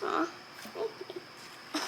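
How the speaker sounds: a tiny, breathless thank you; shy whispered thanks. Real, not acted. Kid whispers thanks to the grown-up after praise. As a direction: whisper it, barely there.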